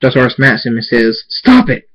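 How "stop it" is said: high, loud sudden command; explosive, scolding yell; sharp shout. Acted. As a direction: shout him out of it. Father smacks son and shouts.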